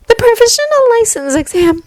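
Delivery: put-on high, thoughtful girl's voice; pensive girl voice. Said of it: "Uraraka mutters pensive".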